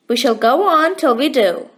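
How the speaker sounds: ordinary delivery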